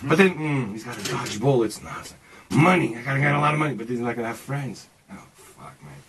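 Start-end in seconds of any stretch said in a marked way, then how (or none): 5.1-6.0 whisper. Retold or imitated. Inner panic, whispered.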